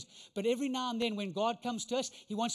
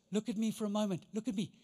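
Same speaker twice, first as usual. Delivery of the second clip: usual speaking voice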